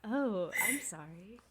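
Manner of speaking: slow, soft imitation of a breathy apology; teasing a friend's breathy slow voice; mocking breathy voice. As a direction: echo her hushed breathy tone to tease. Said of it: "you sound really breathy".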